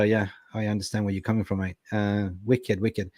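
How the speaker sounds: ordinary talk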